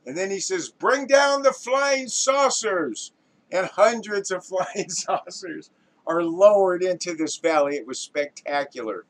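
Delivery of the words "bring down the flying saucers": high, slow, loud command; director's shout. Acted. Director cueing flying saucers, drawn-out shout. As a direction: draw the order out so it carries.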